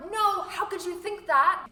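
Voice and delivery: loud, high, drawn-out protest; mock indignation. Acting out disagreement with the gesture, mock indignant. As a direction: object as if appalled at the very idea.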